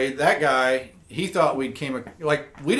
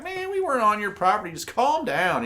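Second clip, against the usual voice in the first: calming the landowner; 8 semitones higher than usual. Retold or imitated. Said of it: "and he's like".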